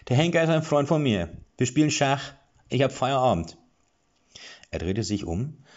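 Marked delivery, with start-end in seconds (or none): 0.1-3.6 higher, louder dwarf voice; casual, off-duty chatter; relaxed dwarf. A narrator's reading. Dwarf explains his chess date with the hangman, high and loud.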